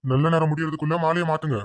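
solemn low officiant's declaration; formal ceremony announcement. Acted.

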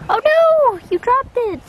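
high, loud oh no; sudden alarmed squeal; surprised cry. Real, not acted. Cat drops treat.

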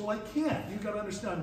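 ordinary talk

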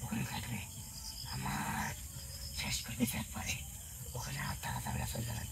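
soft, mostly whispered warning; hushed warning. Real, not acted. Spirit warns companions they cannot stay, hushed. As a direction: warn them under the breath.

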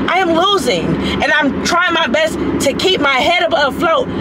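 loud, high-pitched, real angry rant. Real, not acted. Woman venting to online critics about losing and family, angry. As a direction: let it all out at full volume, high and heated.

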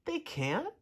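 surprised. Acted. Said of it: "said Tudley".

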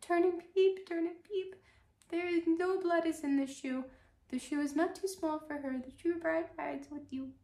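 high chanting pigeon rhyme; pigeons crying out. Acted. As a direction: pitch way up like a cooing pigeon.

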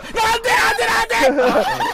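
loud shocked cry; hit hard crying out. Real, not acted. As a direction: yell out in disbelief. Caught and dying in game.

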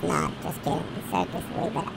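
soft, breathy voiceless aside; whispered correction. Real, not acted. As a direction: let the joke slip out under your breath. Aside after a joke.

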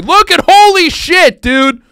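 loud, high burst of disbelief; can't believe what he sees; holy shit, way up high and loud; shocked exclamation. Real, not acted. Shocked at photo.

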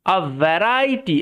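variety drawn out slowly as va-RAI-ti; slow model pronunciation. Shown as a demonstration. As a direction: read out variety slowly for learners. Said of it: "এটার উচ্চারণটা হচ্ছে ভ্যারাইটি". (variety ধীরে টেনে উচ্চারণ)

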